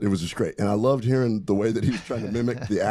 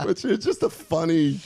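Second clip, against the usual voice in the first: laughing; 12 semitones higher than usual. Real, not acted. Laughing after the story's punchline.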